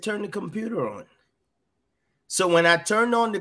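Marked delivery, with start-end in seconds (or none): 0.0-1.0 gentle instruction, softly and higher; small quiet voice, imitating what was heard. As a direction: say it very softly, pitch lifted. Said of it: "the voice said very softly".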